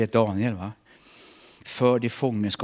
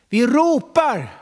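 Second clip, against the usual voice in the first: high, loud, drawn-out cry; shout; 13 semitones higher, 9 dB louder, much slower than usual. Acted. Preacher crying out about prayer to God, loud. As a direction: cry it out to the rafters.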